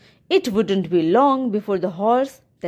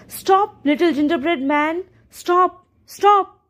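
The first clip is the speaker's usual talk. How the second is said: calling out; 7 semitones higher than usual. Acted. Old man chasing the gingerbread man across his lawn, calling stop. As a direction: shout after him across the lawn, higher and higher. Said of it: "he called".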